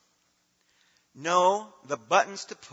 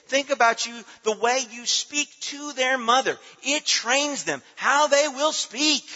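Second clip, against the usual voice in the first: higher, louder, driving sermon voice; preaching at full force; 10 semitones higher, 8 dB louder than usual. Acted. Pastor exhorting the congregation, preaching hard. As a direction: press each point home from the pulpit.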